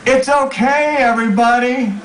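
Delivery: high-pitched calling out; yelling after them. A narrator's reading. Friend calling after fleeing passengers, high yell. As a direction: call high to people leaving. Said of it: "I'm yelling after them".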